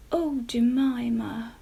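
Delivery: slow, sympathetic sigh; tender sigh. A narrator's reading. Mamma sympathising with Jemima, slow and tender. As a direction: soften it for her.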